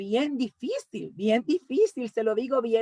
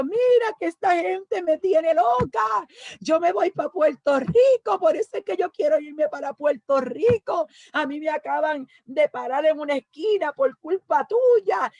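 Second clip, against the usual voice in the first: high, teary retold lament; retold crying complaint; 5 semitones higher, 4 dB louder than usual. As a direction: wail it out as the upset pastor's wife. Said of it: "pero ya yo estaba llorando ahí".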